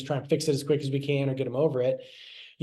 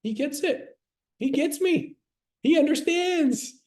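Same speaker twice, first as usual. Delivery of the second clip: put-on relieved child; 12 semitones higher, slower than usual. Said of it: "They're gonna be like".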